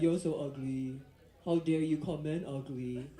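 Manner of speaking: mocking commenters. Mimicking angry male commenters.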